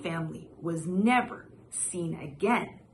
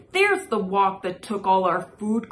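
loud angry outcry; crying out; 6 dB louder than usual. Acted. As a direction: shout it in outrage. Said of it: "cried Missus Li".

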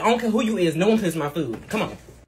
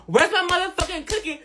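higher, furious demand; angry; 6 semitones higher than usual. Acted. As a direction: demand it in a rage. Furious repeat of question.

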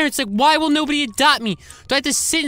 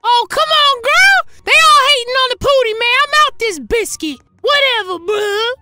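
loud indignant whine; 10 semitones higher, 6 dB louder than usual. Acted. Rejected man complains loudly.

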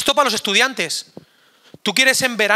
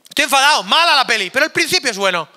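mock annoyed; 4 semitones higher, 6 dB louder than usual. Real, not acted.

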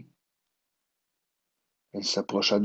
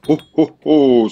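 loud booming oh oh oh; a jolly shopkeeper's hearty cry; jolly exclamation; 6 semitones higher, 15 dB louder than usual. A narrator's reading. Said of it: "s'écria le marchand". (exclamation joyeuse et forte)

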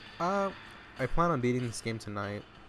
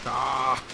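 startled yelp into laughing. Real, not acted. Startled in a game fight.

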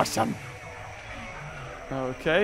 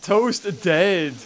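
ordinary talk

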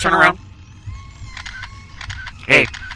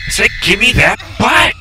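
mock angry shout; 6 semitones higher, 7 dB louder than usual. Repeating demand angrily at game.

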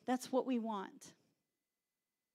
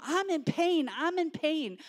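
raised mock cry of pain; pitched-up, half-yelled plea; acted yelling out; 6 semitones higher, 4 dB louder than usual. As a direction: call out high, as if hurting. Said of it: "Someone starts yelling out".

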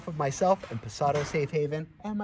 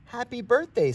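happy greeting; 12 semitones higher, 10 dB louder than usual. Acted. Grandma greets on birthday.

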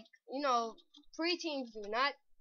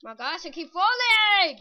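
rising, exasperated shout; angry yell; 5 semitones higher, 10 dB louder than usual. Real, not acted. Gamer keeps falling in parkour, angry yell. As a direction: build to a high, loud cry of frustration. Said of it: "This is getting me angry".

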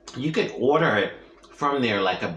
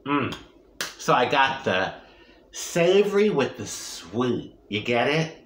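usual speaking voice

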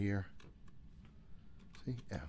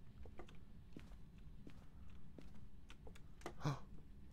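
scared gasp; 14 dB quieter than usual. Real, not acted. Hears door, scared.

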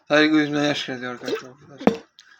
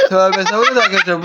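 usual speaking voice

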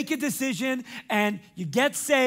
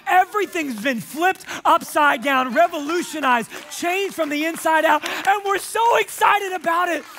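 higher, louder preaching push; building preaching; 8 semitones higher, 6 dB louder than usual. Acted. Pastor urging the congregation to step inside, building to full force. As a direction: drive the point home with force.

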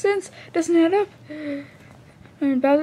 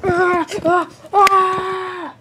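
hammed-up dying groans; 3 semitones higher than usual. Character stabbed, collapsing.

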